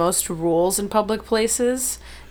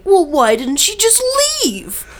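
mocking dismissive; 13 semitones higher, 6 dB louder, slower than usual. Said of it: "were saying".

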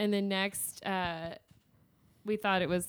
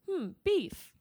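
savoring beef; 10 semitones higher than usual. Retold or imitated. Character savoring chewed gristle.

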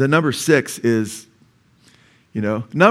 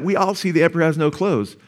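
ordinary delivery

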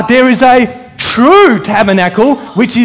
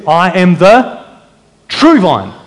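pause, then punch "true"; "true" set apart and accented, falling away into "vine"; stress on TRUE; slower than usual. Shown as a demonstration. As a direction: hold back briefly, then land hard on "true". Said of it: "Emphasis now on true".

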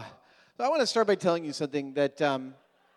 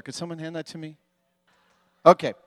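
ordinary talk

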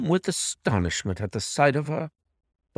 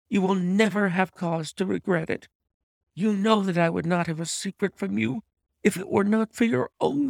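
sustained high-pitched plea; high voice of a despairing woman entreating; earnest, despairing wife; 9 semitones higher than usual. A narrator's reading. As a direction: plead desperately in a high wife's voice. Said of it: "such earnestness, such despair in her manner".

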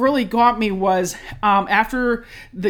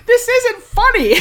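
much higher, louder, slowed protest; mock indignant; 14 semitones higher, 8 dB louder, slower than usual. Complaining about partner laughing while she cried, high indignant protest. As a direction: squeal it out, offended. I'm like.

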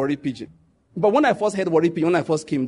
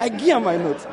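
mock incredulous echo; 4 semitones higher than usual. Narrator echoing the classmate, mildly mocking disbelief. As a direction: echo I give her my note in a lightly mocking tone. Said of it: "I look at the guy like".

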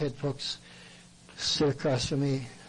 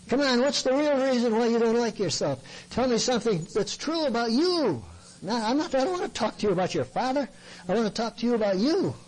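higher-pitched, pressing counselor; insistent confrontation; 6 semitones higher than usual. Retold or imitated. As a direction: push past the excuse, pitch raised. Confronts counselee in retold exchange.

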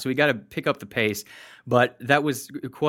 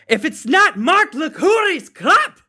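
loud, high, drawn-out mock Scottish yell; Scottish accent; 7 dB louder, slower than usual. Host summing up review for co-host, hammed-up Scottish accent. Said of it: "are you telling me I can't do a good Scottish accent".

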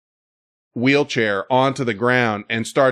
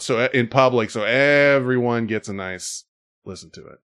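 normal talking voice

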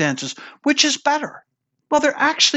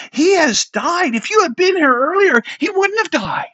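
higher-pitched, pointed retold complaint; acted blunt reproach; 8 semitones higher than usual. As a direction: confront him as the disappointed sister. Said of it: "She was very clear with Jesus".

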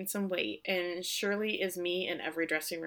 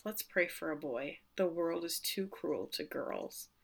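softer, slowed motherly murmur; tender hush; 7 dB quieter than usual. Retold or imitated. Shirley to Rose on her belly, soft and tender.

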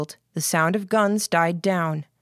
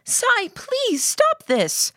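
very high, slow desperate plea; raised voice; much slower than usual. A narrator's reading. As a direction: beg with the pitch pushed right up. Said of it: "Lillian raised her voice".

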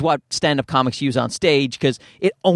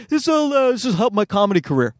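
higher, hurried self-justifying; excuse to wife; 6 semitones higher, faster than usual. Acted. Comic justifying gear purchase to wife, hurried pleading. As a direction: start high, then hurry the excuse through. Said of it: "I'm telling my wife".

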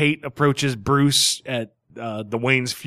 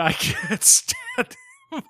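exaggerated disgust; much slower than usual. Exaggerated reply to teasing.

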